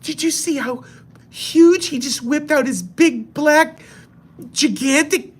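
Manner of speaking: high breathy falsetto, gushing over the huge knife; hammed-up office gossip voice; gossiping coworker. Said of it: "She's like".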